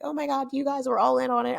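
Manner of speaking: high-pitched amazed realization; amazed mother. Retold or imitated. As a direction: imitate Mom catching on, up high.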